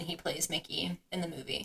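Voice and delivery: unvoiced, hushed aside; whispered aside. Real, not acted. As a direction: whisper the name like a secret.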